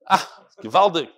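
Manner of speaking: ordinary talk, nothing marked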